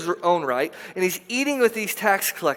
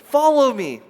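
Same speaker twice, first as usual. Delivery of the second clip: louder inviting call; 7 semitones higher, 5 dB louder, slower than usual. Retold or imitated. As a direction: Follow me called out louder and higher. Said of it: "And Jesus says".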